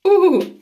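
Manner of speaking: sudden high loud yelp of pain; a sharp cry as the strip stings; pained ooh. Real, not acted. Sharp sting peeling nose strip.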